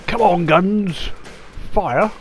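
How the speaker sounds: loud, slow rallying shout; rally the gun crew with a loud, slow shout; calling out to guns. Pretend. Captain urging his guns to fire, shouting.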